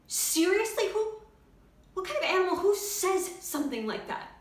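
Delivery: loud, high, incredulous indignation; outraged outburst. Acted. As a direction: burst out in disbelief and anger. Outraged outburst over the patient's insult.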